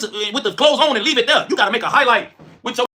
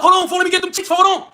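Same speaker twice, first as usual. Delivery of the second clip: excited shout; 9 semitones higher, 3 dB louder than usual. Retold or imitated. Imitating friend rushing in.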